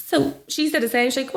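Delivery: usual speaking voice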